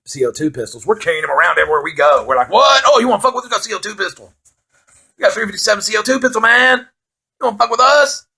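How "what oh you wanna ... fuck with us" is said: high, cocky taunt; mock bravado. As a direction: act out a puffed-up teenage challenge. Teenage bravado with pistols, acted.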